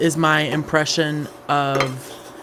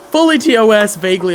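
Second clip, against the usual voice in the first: Fully loud and high, correcting vaguely; loud emphatic correction; 4 semitones higher, 8 dB louder, faster than usual. Real, not acted. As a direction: punch out Fully T O S loudly.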